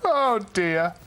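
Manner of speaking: normal talking voice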